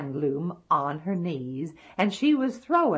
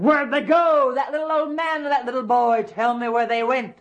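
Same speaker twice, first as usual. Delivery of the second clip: high, loud angry demand; impatient monster yelling; shouting giant; 6 semitones higher, 7 dB louder, faster than usual. Acted. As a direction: bellow the question at a deaf granny. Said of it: "you don't need to shout so".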